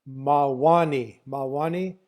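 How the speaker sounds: "Mawani" with the weight on WA; second-syllable stress. Shown as a demonstration. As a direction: demonstrate "Mawani" slowly, leaning on the second syllable. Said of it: "it's got second syllable stress".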